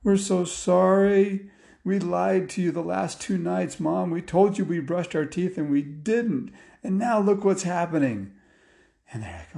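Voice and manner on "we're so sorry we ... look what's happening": put-on high, whiny kid apology; acted remorse, pitched up; confessing boys. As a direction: play children owning up, pleading. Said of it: "the boys finally confessed".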